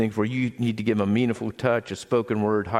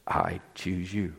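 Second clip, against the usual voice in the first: soft, slow and lower; tender assurance; 4 semitones lower, 6 dB quieter, slower than usual. Acted. Pastor voicing the Father in heaven to us, tender and soft. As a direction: say it softly as a solemn promise. Voices God's words to listeners.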